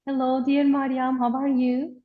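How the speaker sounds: normal talking voice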